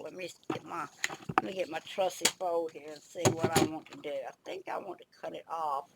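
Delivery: ordinary talk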